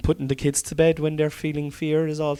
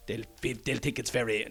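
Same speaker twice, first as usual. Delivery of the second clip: put-on Chinese accent; 5 dB quieter than usual. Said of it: "it's a really bad Chinese accent".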